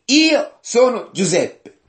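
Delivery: high, slow declaimed self-reveal; emphatic scripture quote, drawn out; dramatic revelation. Acted. Joseph reveals himself to brothers.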